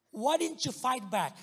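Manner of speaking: slow, loud, accusing police question. Retold or imitated. A police officer grilling the victim, loud and accusing. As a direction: press him, why didn't he resist.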